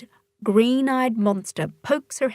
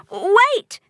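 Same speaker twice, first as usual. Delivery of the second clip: high sudden cry of wait; girl calling out urgently; 11 semitones higher, 4 dB louder than usual. Acted. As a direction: stop everything, urgent. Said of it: "says Polly".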